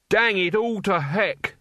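high, drawn-out mock curse; forced shout. A narrator's reading. Truckle strains to swear politely for his teacher, shouting. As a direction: blurt mild swear words slowly with great effort. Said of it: "he shouted".

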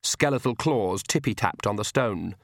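ordinary delivery

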